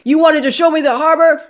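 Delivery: high, loud indignant gangster; offended mob boss. Retold or imitated. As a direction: sound personally insulted.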